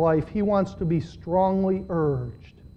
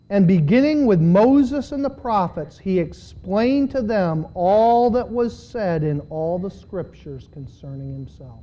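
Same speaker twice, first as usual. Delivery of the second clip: scripture reading; 5 dB louder than usual. Acted. Reads scripture aloud.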